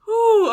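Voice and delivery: loud relieved exhale. Real, not acted. Composing herself after laughing.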